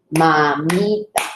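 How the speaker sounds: "Mamita" drawn out slowly, syllable by syllable. Shown as a demonstration. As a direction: stretch "Mamita" so the parts can be heard. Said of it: "Y luego corta".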